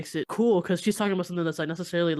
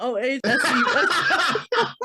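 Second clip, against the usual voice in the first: laughing; 7 semitones higher, 5 dB louder, much slower than usual. Acted. Spelling name while laughing.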